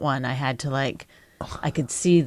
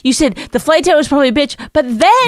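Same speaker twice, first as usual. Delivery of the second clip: much higher and louder, rushed accusation; heated protest; 10 semitones higher, 6 dB louder than usual. Real, not acted. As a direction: throw his own words back at him. Heated argument with husband.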